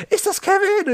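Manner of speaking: very high-pitched mimicked caller; imitated high background voice on the line; other voice in the background. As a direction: squeal it like someone in the background of a phone call. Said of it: "eine andere Stimme so im Hintergrund".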